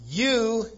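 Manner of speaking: "You" high and loud, standing alone; very emphatic. Retold or imitated. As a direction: punch "You" like an accusation. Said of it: "is very emphatic".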